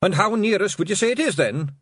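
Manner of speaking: high, loud Scots pixie chat; casual despite the danger; conversational pixie. Acted. Rob asking William, high casual Scots voice. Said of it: "asked Rob conversationally".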